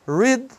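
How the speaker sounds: "Read" opened with a retroflex English r; caipira R. Shown as a demonstration. Teacher modelling the R, caipira r in "Read". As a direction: start "Read" on a caipira-style r. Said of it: "o som do R do caipira".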